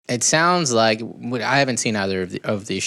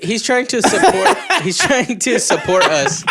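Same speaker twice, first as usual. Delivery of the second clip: high, breaking up mid-sentence with laughter; laughing; 10 semitones higher, 4 dB louder than usual. Real, not acted. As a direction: laugh helplessly through the protest. Laughing guilt over the prank.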